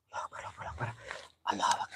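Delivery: voiceless frightened prayer; hushed prayer in fear; whispered prayer. Real, not acted. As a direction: whisper the prayer to calm yourself. Praying in fear at night.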